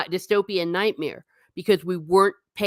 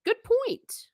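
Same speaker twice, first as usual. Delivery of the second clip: Good point in a much higher, bright-pitched voice; emphatic agreement. Retold or imitated. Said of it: "And I'm like".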